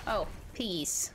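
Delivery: ordinary delivery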